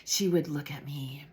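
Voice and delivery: normal talking voice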